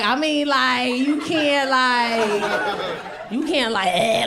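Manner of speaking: drawn-out parody of daughter's speech; talking like her. Retold or imitated. Parent refusing the party in daughter's own extra manner. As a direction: mimic her stretched, extra "like". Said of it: "So I had to talk like her".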